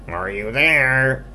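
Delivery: high, loud, drawn-out piggy call; searching call. Acted. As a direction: call out slowly for someone hiding. Pig calling for hidden skunk.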